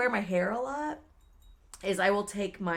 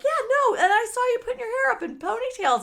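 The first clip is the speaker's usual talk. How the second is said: very high, loud imitation of a friend; excited friend; 6 dB louder than usual. Friend recounts seeing the hair go up, excited. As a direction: chatter it out in a raised voice.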